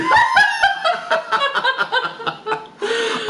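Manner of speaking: squealing laugh; helpless shrieking laugh; high shriek of laughter. Real, not acted. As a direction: let out a high cackle. Laughing at the stream.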